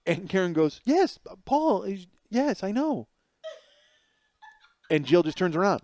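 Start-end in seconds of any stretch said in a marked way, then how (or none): 0.8-3.1 high, slow imitated reply; oblivious agreement. Karen answering the friend's wife, obliviously. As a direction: lightly imitate a wife who misses the problem.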